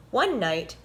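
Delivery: usual speaking voice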